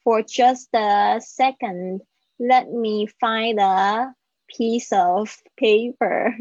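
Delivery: "for just a second" said slowly with the words joined; each phrase slowed down so the links are heard; teacher's slowed-down model; slow linking demo. Shown as a demonstration. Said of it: "这里有一个连音".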